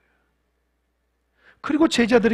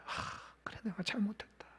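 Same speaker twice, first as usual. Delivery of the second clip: whispered confession; 17 dB quieter than usual. Retold or imitated. Peter's confession in the story.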